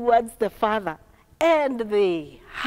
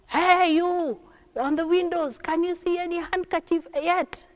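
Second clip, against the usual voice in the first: higher-pitched calling voice; boy calling from the train; 8 semitones higher than usual. Acted. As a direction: call across the carriage to the window seats. Boy calls to window passengers again.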